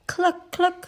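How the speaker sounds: high clucking hen. Acted. Hen telling the other hens, high clucking.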